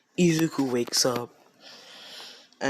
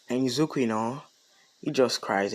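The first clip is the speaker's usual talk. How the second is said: plain reading voice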